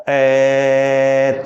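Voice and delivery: "ए" held very long and level; sustained vowel. Shown as a demonstration. Teacher proving a vowel stays unchanged, "ए" held long. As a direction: stretch "ए" out on one steady note. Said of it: "चाहे मैं कितनी देर तक इसका उच्चारण".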